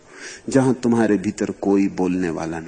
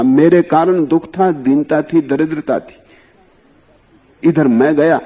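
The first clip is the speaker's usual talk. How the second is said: raised, louder preaching voice; impassioned sermon; fervent preaching; 6 semitones higher, 7 dB louder than usual. Acted. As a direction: proclaim it to the listeners. Emphatic preaching run.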